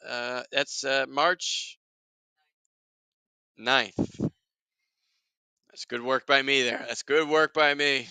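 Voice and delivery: ordinary talk, nothing marked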